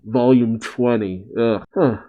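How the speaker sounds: ordinary talk, nothing marked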